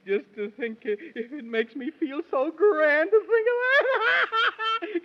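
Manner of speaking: high, giggling words that break into laughter; laughing through it. Acted. The Man in the Moon giggling, too tickled to finish. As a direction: squeal the line between laughs.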